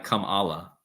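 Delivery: Kamala as kum-AH-luh, middle stressed; sound-alike pronunciation. Shown as a demonstration. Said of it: "Susan says it sounds like".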